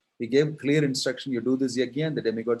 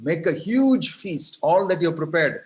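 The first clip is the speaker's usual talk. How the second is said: excited command; 5 semitones higher, 6 dB louder than usual. Acted. Krishna telling the cowherds to make a feast, loud and joyful. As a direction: announce the feast with joy.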